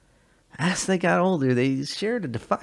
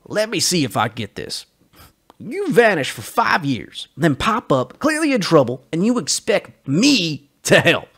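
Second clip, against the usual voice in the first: higher, louder, slowing disbelief; incredulous anger; 6 semitones higher, 9 dB louder than usual. Acted. Joe confronts his vanished friend, loud and disbelieving. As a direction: throw his own story back at him.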